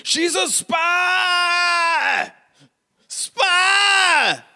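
very high, drawn-out screamed alarm; desperate scream. Acted. Choked mayor alerting others that she's a spy, screaming. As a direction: scream it out, stretching each word way up high. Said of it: "And then he screams".

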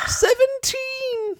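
high, drawn-out exclamation; excited. Retold or imitated. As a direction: cry the number in relief. Roll result exclaimed.

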